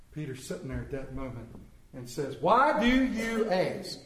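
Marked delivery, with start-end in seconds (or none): 2.4-4.0 high, slow, drawn-out question; emphatic protest. Acted. Peter protests at being asked, high and drawn out. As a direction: stretch each word in raised-voice protest.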